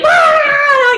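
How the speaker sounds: very high, loud whoop of joy; cheering shout. Retold or imitated. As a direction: let out the kids' cheering yell. Kids cheering.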